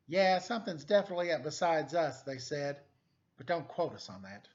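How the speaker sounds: animated picture book reading; story narration. A narrator's reading. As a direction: read it like a noir storybook to kids. Reads picture book aloud.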